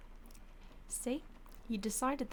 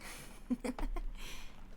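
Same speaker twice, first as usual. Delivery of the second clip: breathy laugh; 2 semitones higher than usual. Acted. Vampire laughing after threat.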